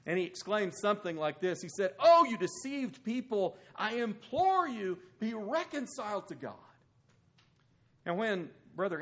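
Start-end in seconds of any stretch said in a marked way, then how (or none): 2.0-6.5 higher, louder pleading exclamation; a preacher's heated plea to his opponents; impassioned plea. Retold or imitated. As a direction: implore the crowd with fervour. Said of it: "very frustrated and impassioned, and he exclaimed".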